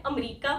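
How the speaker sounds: 'अमेरिका' squeezed to 'am-REE-ka'; common mispronunciation. Retold or imitated. Teacher quotes how people say it, mispronounced 'अमेरिका'. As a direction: imitate the local 'amrika' for 'अमेरिका'. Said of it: "लेकिन अमेरिका गलत है".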